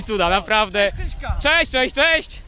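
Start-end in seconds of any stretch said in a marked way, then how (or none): 1.4-2.4 calling out greetings. Real, not acted. Greeting passing fans loudly.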